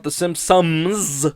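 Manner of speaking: "the Simpsons" said slowly, every sound of "Simpsons" spelt out; over-enunciated. Shown as a demonstration. Host telling listeners apart two show names, carefully over-enunciating "Simpsons". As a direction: stretch out "Simpsons" syllable by syllable so no one hears another name. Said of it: "I feel like I have to over-enunciate".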